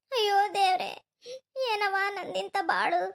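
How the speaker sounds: slow, grief-stricken wail trailing into sobs; tearful despair breaking into sobbing; crying. Acted. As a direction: lament your fate through tears. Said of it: "ಅಳ್ಬೇಡ, ಸುಮ್ನಿರವ್ವ".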